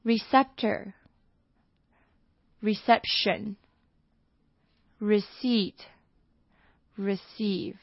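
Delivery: "Receptor, reception, receipt, receive" each said very slowly and separately; teacher reading the list of words aloud. Shown as a demonstration. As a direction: read the four words out one by one for the class. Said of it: "我们再把四个都念一遍".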